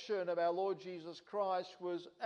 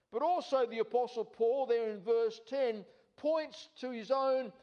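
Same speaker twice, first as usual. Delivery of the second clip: higher and louder, preaching at full force; fervent preaching; 4 semitones higher, 3 dB louder than usual. Acted. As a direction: drive each claim home from the pulpit.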